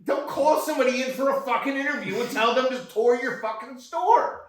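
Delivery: fast, high, loud furious yelling; angry rant. Acted. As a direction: rail at them in full fury. Angry rant at Trader Joe's.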